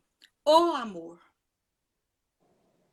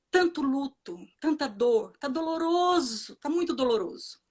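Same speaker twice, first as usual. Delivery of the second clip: ordinary talk, nothing marked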